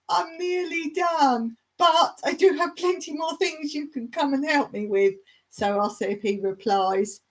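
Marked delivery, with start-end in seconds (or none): 0.1-5.1 acted polite put-off. Gardener retelling her reply to an eager helper, polite put-off. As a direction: let him down in a lifted voice.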